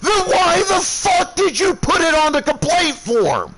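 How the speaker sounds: shouted high and hard; enraged roaring demand; furious yelling. Retold or imitated. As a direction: blow up at him, full fury. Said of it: "I am livid".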